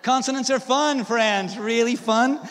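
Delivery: high, very loud rallying cry; emphatic, shouted enthusiasm; loud proclamation. Real, not acted. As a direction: belt it out to the crowd. Mock-rousing announcement to audience.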